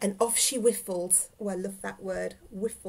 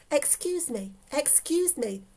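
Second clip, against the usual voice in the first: high, light apologetic call; raised-pitch, polite old lady; politely pushing past; 6 semitones higher than usual. Acted. Old lady squeezing past the couple.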